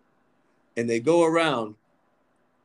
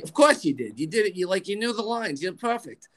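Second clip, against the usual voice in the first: higher, insistent New York mother impression; 9 semitones higher than usual. Mom backing her son's performance, New York accent. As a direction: reassure him as a doting New York mom. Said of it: "She talks like this".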